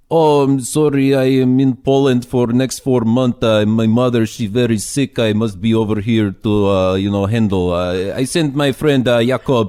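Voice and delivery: bad Polish accent impression, played big. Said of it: "I'm gonna do my worst Polish accent ever".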